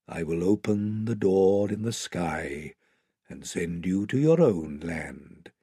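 slow, deep, stately lion voice; Aslan, solemn lion. Acted. Great lion promising to open the door, slow and solemn. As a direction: reassure them with slow, low authority.

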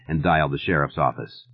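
ordinary delivery

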